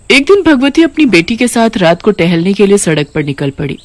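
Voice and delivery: plain reading voice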